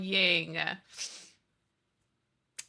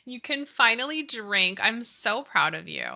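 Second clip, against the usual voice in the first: ordinary delivery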